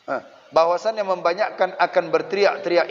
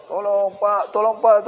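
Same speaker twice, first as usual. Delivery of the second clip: higher pleading cry growing louder; mock calling for help; 5 semitones higher, 3 dB louder than usual. Child in class begging a passing man for help, building mock cry. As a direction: beg the passer-by, building up. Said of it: "bisa minta tolong itu".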